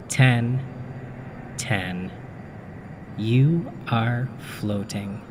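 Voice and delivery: measured hypnotist's induction with long pauses; deliberate, spaced-out hypnotic guidance; hypnotic countdown. Acted. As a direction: count the listener down into a trance, unhurried. Hypnotist counts the listener into dream.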